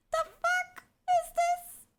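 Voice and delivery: very high, slow squeal; drawn-out shriek. Real, not acted. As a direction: stretch it out high in disbelief. Drawn-out disbelief.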